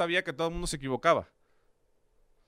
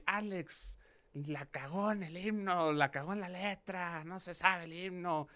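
mock headlines; 4 semitones higher, 5 dB quieter, slower than usual. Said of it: "Varios medios así".

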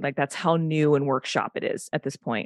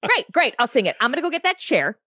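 high-pitched, pushy re-enactment of herself; mock-aggressive, charge ahead with it; angry over-eagerness; 6 semitones higher, faster than usual. Angry auditioning actor to casting director, pushy over-eagerness. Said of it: "so fucking blind in my own anger".

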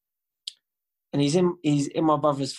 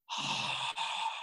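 heavy sigh; 10 dB quieter than usual. Retold or imitated. Brother realising.

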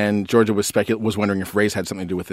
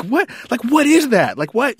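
high-pitched, incredulous outburst; so mad; 5 dB louder than usual. Retold or imitated. Dad retelling his dress-code rant in the car, so mad. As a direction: replay being indignantly baffled, voice jumping up. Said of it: "I just was so mad".

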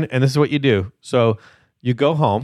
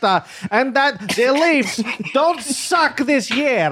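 slow, high, drawn-out mock Russian accent; 6 semitones higher, slower than usual. Host jokingly prays over a caller for power, thick Russian accent. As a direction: pray solemnly in a hammed-up Russian accent. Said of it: "actually with a Russian accent".

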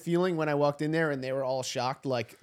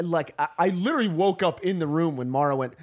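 normal talking voice

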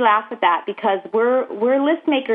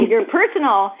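laughing. Real, not acted.

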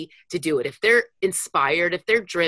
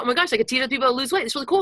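higher, fast gushing; excited; 5 semitones higher, much faster than usual. Retold or imitated. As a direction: rush it out in delight. Retold excited realization.

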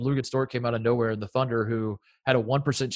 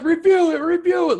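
high, loud hammed-up demand; mock frantic yell; 6 dB louder than usual. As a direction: yell it like a coach losing his mind. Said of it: "losing his mind".

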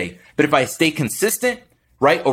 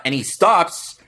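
usual speaking voice

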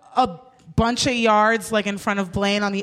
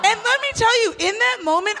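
normal talking voice

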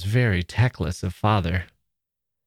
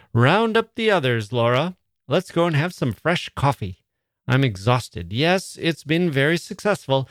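exhausted hostess mother; 6 semitones higher than usual. Acted. Mrs Sheridan, exhausted hostess.

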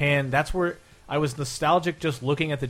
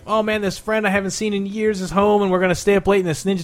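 higher-pitched, eager run-on recollection; reliving childhood excitement; 4 semitones higher, 4 dB louder than usual. Retold or imitated. Kid thrilled his old friend is home to stay over, excited.

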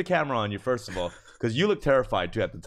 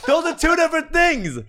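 exasperated outburst; 11 dB louder than usual. Real, not acted. Arguing back, laughing, exasperated.

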